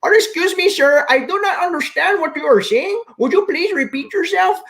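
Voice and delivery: high, stiffly formal phone-support impression; mock overseas support agent. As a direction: play the agent who can't follow the caller. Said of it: "and they say".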